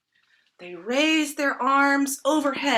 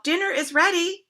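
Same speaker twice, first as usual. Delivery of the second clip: raised, high calling voice; called out; 8 semitones higher, 6 dB louder than usual. A narrator's reading. Grandfather announces dinner, calling out. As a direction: call across to the living room. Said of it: "grandfather called out".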